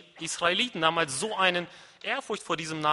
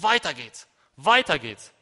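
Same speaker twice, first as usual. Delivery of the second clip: loud, punchy cornerman's urging; forceful trainer's call; not quiet; 3 semitones lower, 6 dB louder than usual. Acted. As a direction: rouse a worn-out fighter. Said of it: "Er hat das nicht leise gesagt".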